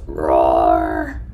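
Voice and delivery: very low growling roar; bear roar. Shown as a demonstration. Teacher roaring like the brown bear for the children. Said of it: "It growls".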